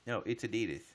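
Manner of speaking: ordinary talk, nothing marked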